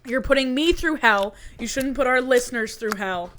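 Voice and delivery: loud, emphatic telling-off; playful scolding. Real, not acted. Host scolds co-host for mic noise, exasperated. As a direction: lay down the law, loudly.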